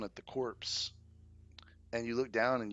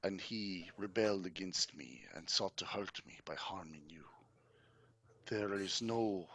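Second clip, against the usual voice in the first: courtly count, contrite; 5 dB quieter than usual. Acted. Count apologizing to Irina for his servant's offense, contrite and courtly. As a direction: let each phrase fall away with grave pauses. Game master voices Strahd apologizing.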